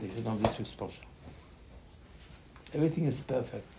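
ordinary talk, nothing marked

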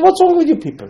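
very high, loud indignant question; shocked rebuke. Acted. Rebuking sons who want their fathers dead, shocked. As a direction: scold them in a high-pitched outburst.

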